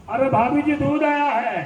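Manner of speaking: high-pitched call from the street to bhabhiji; milkman calling up. Retold or imitated. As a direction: call up to the balcony like a milkman does. (દૂધવાળાની ઊંચા સૂરે બૂમ)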